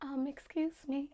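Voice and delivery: timid, hushed excuse me; softly, politely try to get someone's attention; imitating own small, soft voice; soft-spoken. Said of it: "I can be very soft-spoken".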